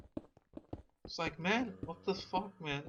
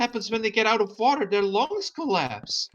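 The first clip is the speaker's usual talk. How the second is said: preachy Becky; 7 semitones higher than usual. Retold or imitated. Young woman lecturing fishermen about fish, preachy. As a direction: scold the fishermen like a know-it-all.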